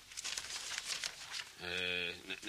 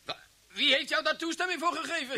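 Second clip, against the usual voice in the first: indignant outburst; 11 semitones higher, 3 dB louder than usual. Acted. Chief outraged at inspector.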